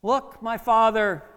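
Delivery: slow, high, loud call; David. Acted. David calling to Saul as father, loud appeal. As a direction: hail him from afar, drawing out the words. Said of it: "he said to King Saul".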